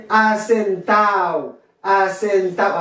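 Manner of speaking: asentao drawn out as "a-sen-TAO", no D; "asentao", the D of the ending left silent; dropped D. Shown as a demonstration. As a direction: say asentao with A sliding into O. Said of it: "ou qu'à prononcer ou qu'à pas".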